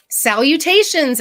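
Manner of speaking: high, slow, grand greeting; lightly voiced dinosaur savoring a big word; a hint of a pompous little dinosaur; as Theo would. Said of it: "as Theo would say".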